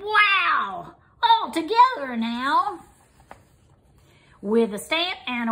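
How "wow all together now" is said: high, delighted call; excited frog cry. A narrator's reading. Frog calls all the animals in, higher excited cheer. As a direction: cheer everyone on together. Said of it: "Frog cried".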